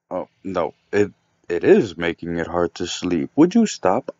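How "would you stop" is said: hoch und laut, genervter Nachbar; wütend. Acted. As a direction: fahr ihn scharf an. Angry neighbour woken at night.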